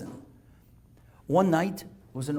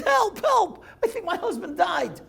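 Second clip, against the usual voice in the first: acted cry for help, very high-pitched; high, hammed-up panic; panicked screaming; 13 semitones higher than usual. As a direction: voice a frantic wife calling from the window. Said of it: "she's yelling, and she's screaming".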